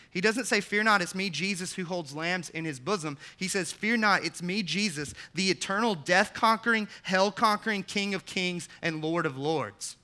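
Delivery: loud, high, triumphant preaching; preaching at full force. Acted. Pastor contrasting the meek picture with the conquering king, full-force preaching. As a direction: proclaim it like a war cry of victory.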